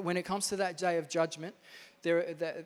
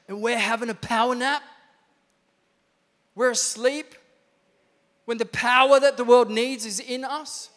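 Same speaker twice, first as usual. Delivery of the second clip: loud, raised, urgent preaching voice; full-force preaching; 5 semitones higher, 10 dB louder than usual. Real, not acted. Preacher rousing the church to use its power, fired-up preaching.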